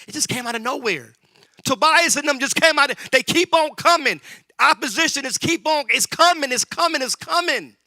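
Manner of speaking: high, loud shouted build; rising preacher's shout; preaching climax. Acted. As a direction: cry it out to the church.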